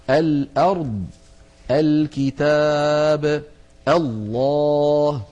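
"الأرض", "الكتاب", "الله" each begun on "a", drawn out slowly; always open hamza. Shown as a demonstration. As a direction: chant "الكتاب" and "الله" long, opening with fatha. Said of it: "وتكون مفتوحة دائما". (تمديد «الأرض» و«الكتاب» و«الله» بهمزة مفتوحة)

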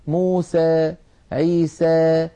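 موسى، عيسى with the final alef tilted slightly toward e, said slowly; light imala. Shown as a demonstration. Teacher imitating tribes who soften the alef in موسى، عيسى. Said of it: "فيقولون مثلًا".

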